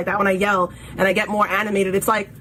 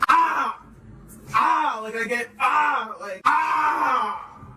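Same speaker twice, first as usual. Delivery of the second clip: demo yell; much slower than usual. Shown as a demonstration. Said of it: "when I'm flaming a motherfucker, I go higher".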